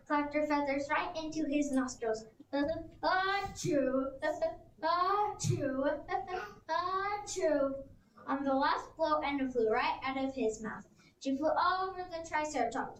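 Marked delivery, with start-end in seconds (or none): 2.5-7.7 laughing fake sneezes; mock sneezes with giggles. Feathers in T. rex's nostrils, giggly mock sneezes.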